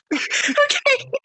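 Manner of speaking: high, slow words squeezed through laughter; helpless laughter, barely getting words out; breathless laughing. Real, not acted. Friend cracking up at the nuggies, breathless laughing. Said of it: "I can't breathe".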